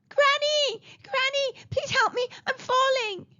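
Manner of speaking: put-on very high child's cry of panic; crying for help. A narrator's reading. Boy falling into a tunnel calls granny, panicked cry. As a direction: call out desperately, pitched right up. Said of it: "Chiku cried".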